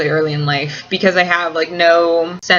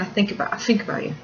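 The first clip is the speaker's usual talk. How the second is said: soft Cockney, 'think' said as 'fink'; attempted Cockney; 9 dB quieter, much faster than usual. Shown as a demonstration. Explaining the accent to a fan, soft Cockney. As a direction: repeat it softly in a London accent. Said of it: "That's not sleeping, that's just me saying".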